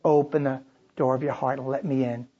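put-on soft, low, gentle call to a sweet child; tender invitation. A narrator's reading. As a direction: coax him in tenderly. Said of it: "It came like a precious knock".

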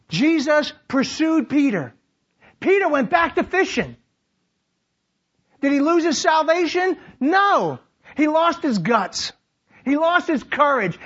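high, driving sermon delivery; rousing, declamatory preacher; full-force preaching. Real, not acted. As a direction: preach it out to the whole room.

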